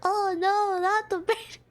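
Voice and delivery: mock despair through giggles; laughing groan. Real, not acted. Streamer laughing as chat suggests accents.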